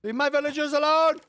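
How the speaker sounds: high, loud protective shout; furious defence of his villagers; angry yell. Real, not acted. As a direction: yell it to drive raiders off. Yelling at raiders in game.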